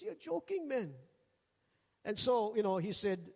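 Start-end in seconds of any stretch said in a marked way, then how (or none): 0.0-0.9 much higher, teasing disbelief; imitating a doubting friend; mock disbelief. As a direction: laugh it off as a joke.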